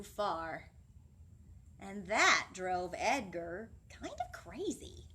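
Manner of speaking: put-on animated children's story voice; storytime reading. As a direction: read it to the class with big expression. Reads picture book aloud.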